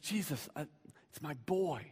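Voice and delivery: soft, faltering acted appeal; hushed father's appeal; helpless plea. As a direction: plead quietly, barely pushing the words.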